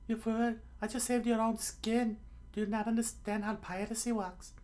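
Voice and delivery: very high falsetto scolding; high cartoon exasperation; scolding pirate girl. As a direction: tell them off in a squeaky voice.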